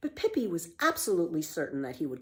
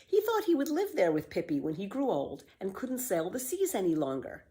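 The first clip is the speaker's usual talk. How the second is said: ordinary delivery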